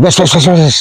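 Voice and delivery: rapid string of "wes" bursts; noisy repeated whooshes. Shown as a demonstration. Contrasting with the steady bird, repeated imitated whooshes. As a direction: imitate a bird flapping noisily on descent. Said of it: "nggak sampai bunyi".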